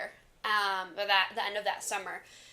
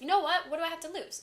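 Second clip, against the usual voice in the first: higher and bolder, talking herself into it; sudden daring; 7 semitones higher than usual. Retold or imitated. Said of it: "and I was like".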